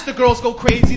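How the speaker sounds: normal talking voice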